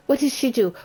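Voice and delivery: ordinary talk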